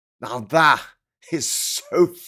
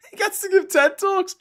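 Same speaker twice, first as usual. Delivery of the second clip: incredulous; 3 dB louder than usual. Real, not acted. Incredulous rant, laughing.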